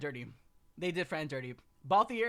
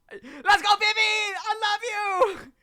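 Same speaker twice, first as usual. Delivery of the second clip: high, loud, slow cheer; excited cheering; 11 semitones higher, 8 dB louder, slower than usual. Real, not acted. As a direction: cheer at the top of your lungs. Cheering for favourite character.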